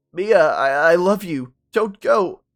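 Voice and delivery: loud, high begging; desperate plea. Acted. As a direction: cry out to stop her. Ben pleads desperately.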